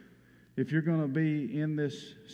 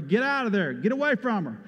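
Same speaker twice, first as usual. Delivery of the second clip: raised, urgent warning shout; retold holler; 6 semitones higher, 4 dB louder than usual. Preacher retelling how he yelled to the crew, a raised warning shout. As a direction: call the crew off the panicked heifer. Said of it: "I hollered to everybody".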